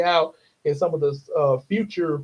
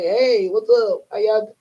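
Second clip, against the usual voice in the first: friendly chat greeting; 6 semitones higher, 3 dB louder than usual. Pretend. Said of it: "like, say".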